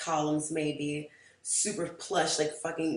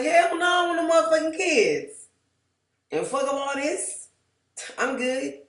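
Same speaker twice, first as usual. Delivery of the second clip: high, loud, emphatic refusal; loud outburst; 11 semitones higher, 9 dB louder than usual. Retold or imitated. Answering her date about kids, loud and high. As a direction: blast it out like it's obvious.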